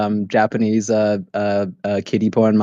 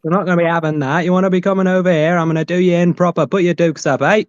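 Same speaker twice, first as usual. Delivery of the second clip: hammed-up Sheffield tough, higher; Sheffield accent; 6 semitones higher than usual. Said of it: "That's my Sheffield".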